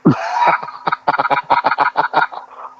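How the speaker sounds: long, loud wheezing laugh; real laugh. Real, not acted. Host cracking up at a co-host's crack joke, loud laugh. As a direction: crack up hard, loud and wordless.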